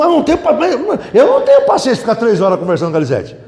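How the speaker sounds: ordinary talk, nothing marked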